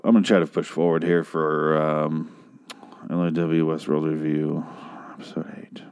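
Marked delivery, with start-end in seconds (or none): 5.1-5.8 unvoiced whisper, tossed off. Host whispering the episode number to viewers. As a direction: breathe the words like a secret.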